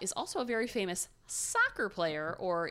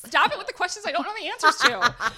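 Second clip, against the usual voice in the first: playful loud scold; 10 semitones higher, 12 dB louder than usual. Real, not acted. Mock scolding the questioner.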